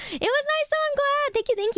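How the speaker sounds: excited chirp. Real, not acted. Happy stream wrap-up.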